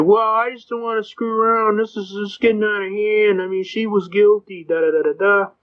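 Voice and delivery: high mock grumbling; imitated complaining cop. Cop pleading that she was guilty, high mock complaint. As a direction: raise the pitch and rattle off a mock complaint.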